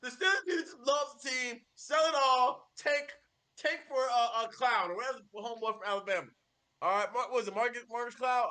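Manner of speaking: normal talking voice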